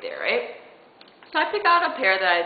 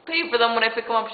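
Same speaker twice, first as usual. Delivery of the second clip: starting to freak out; 5 semitones higher, 4 dB louder than usual. Retold or imitated. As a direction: act out mounting panic as you plead. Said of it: "starting to freak out".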